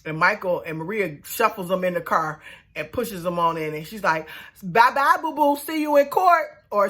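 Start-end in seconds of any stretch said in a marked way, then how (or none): 4.6-6.5 high singsong mock farewell; taunting. Maria gloating at defeated Sarah, taunting. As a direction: imitate a gloating goodbye, pitched up.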